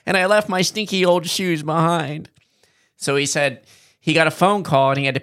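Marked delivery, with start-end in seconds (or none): 0.1-2.3 professor voice. Retold or imitated. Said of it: "So he's like".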